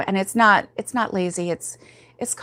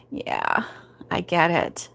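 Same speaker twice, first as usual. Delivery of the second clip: whispered sympathy; 4 dB quieter, slower than usual. Real, not acted.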